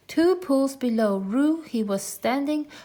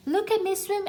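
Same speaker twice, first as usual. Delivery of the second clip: little Roo; 10 semitones higher than usual. A narrator's reading. Roo showing off in the stream.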